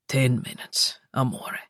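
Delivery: low tender coax; low male voice. Acted. Luca voiced.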